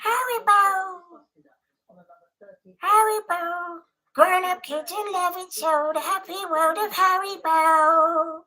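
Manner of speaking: high, sing-song jingle voice; advert jingle voice. Retold or imitated. Streamer mimicking the Haribo advert, high sing-song voice. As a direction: chirp it like a sweets jingle, stretching the ending.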